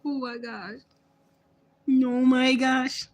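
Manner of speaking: drawn-out disbelieving laughter; laughing outburst. Real, not acted. Cracking up at rival.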